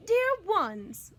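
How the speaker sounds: put-on a mother addressing her children at dinner. A narrator's reading. Teacher reading, lightly voiced sweet mother. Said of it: "Missus Willoughby said".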